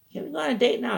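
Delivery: high-pitched put-on girl voice; imitated girlfriend. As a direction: ask in a mock girlish falsetto. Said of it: "she says".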